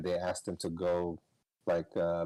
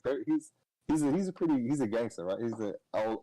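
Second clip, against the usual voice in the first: ordinary delivery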